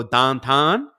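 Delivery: "downtown" with flat Pittsburgh "ah" vowels, like "dahntahn"; Pittsburgh pronunciation. Shown as a demonstration. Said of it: "that's how they say it".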